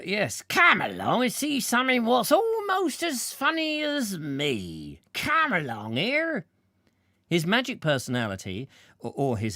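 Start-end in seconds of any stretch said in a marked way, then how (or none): 0.0-6.5 clown barker. Acted. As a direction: call the crowd in like a clown. Clown barker calling crowd.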